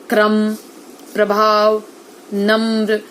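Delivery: "प्रभाव" with the r joined to प, no vowel between; joined r cluster. Shown as a demonstration. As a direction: say "क्रम" and "नम्र" with r fused into the cluster. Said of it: "र को क और प और म के साथ मिलाकर बोलना". (र को व्यंजन से मिलाकर साफ़ उच्चारण)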